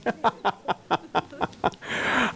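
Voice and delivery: rapid, rhythmic ha-ha laugh. Real, not acted. Speaker joking to the audience about translation lag, laughing. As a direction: laugh in a long, evenly pulsed run.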